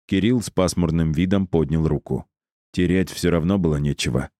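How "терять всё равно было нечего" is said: usual speaking voice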